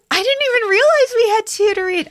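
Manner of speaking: very high, loud excited squeal; delighted surprise. Real, not acted. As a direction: squeal in happy disbelief. Thrilled by the surprise.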